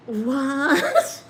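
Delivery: high, rising, incredulous "what"; shocked disbelief. Retold or imitated. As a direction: react as if you can't believe it. Reaction to friend's confession.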